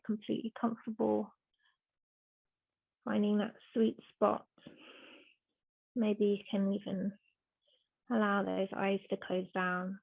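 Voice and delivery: soft, hushed yoga instruction with long pauses; unhurried, softly spoken relaxation guidance; guided meditation. Real, not acted.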